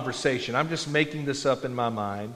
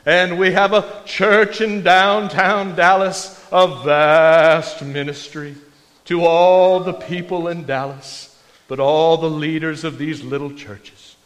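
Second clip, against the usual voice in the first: put-on slow, louder, booming old-time preacher; slow, louder old Baptist preacher drawl; W A Criswell impression; 5 dB louder, slower than usual. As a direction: savour each word like a grand Southern pulpit voice. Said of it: "he would just talk like that".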